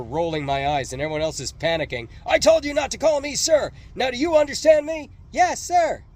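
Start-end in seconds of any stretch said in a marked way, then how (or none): 2.3-5.1 high, loud furious drill-sergeant roar; yelling sergeant. A narrator's reading. Drill sergeant berating the recruits, furious yell. 5.3-6.0 high, loud panicked recruit shout; shouted reply. A narrator's reading. Frightened recruits answering the sergeant, loud shout. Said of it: "had to shout".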